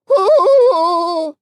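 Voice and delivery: loud high whoop going up; Piglet's startled hoo as he flies up. A narrator's reading. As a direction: yelp in midair. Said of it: "he said".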